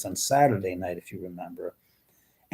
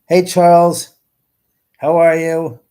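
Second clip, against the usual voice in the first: loud greeting; 6 semitones higher, 11 dB louder than usual. Real, not acted. Greeting live chat viewers loudly.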